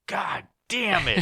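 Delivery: high, slow, drawn-out mock despair; exasperated groan. Imitating himself watching the comedy bomb, slow high groan. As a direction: stretch it out like a cringing sigh to the sky. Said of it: "And I'm like".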